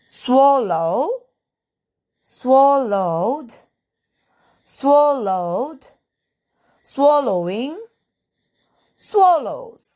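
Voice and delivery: swallow, swallowed, swallowing, swallows, each slow and separate; verb forms drill. Shown as a demonstration. Teacher reading out the verb forms for students. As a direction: pause after each form. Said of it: "എങ്ങനെയാണ് ഉച്ചരിക്കുക എന്ന് നോക്കാം".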